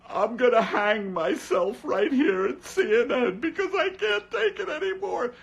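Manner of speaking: high, loud wailing newsreader; mock crying anchor. Wolf Blitzer breaking down on CNN, hammed-up wailing. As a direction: sob out the broadcast, falling apart. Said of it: "Wolf is just crying".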